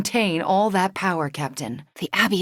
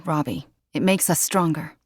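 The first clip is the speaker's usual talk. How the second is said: ordinary talk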